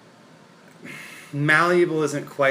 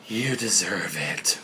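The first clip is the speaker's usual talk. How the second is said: slow, lower, softer put-on voice; imitated quote; 5 semitones lower, 4 dB quieter, slower than usual. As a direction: draw it out slowly, mimicking someone. Quoting what Jay said.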